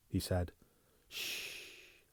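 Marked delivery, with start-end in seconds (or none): 1.1-2.0 soft whispered shush; hushing whisper. Acted. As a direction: hush him gently. Hushing him tenderly.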